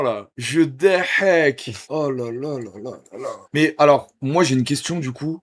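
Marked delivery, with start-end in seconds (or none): 0.4-3.3 slow, theatrical evil chuckle; villain laugh. Pretend. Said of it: "J'ai envie de faire un rire de méchant".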